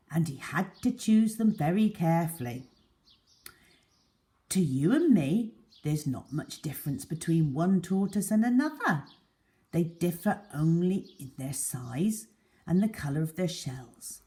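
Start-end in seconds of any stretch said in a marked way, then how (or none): none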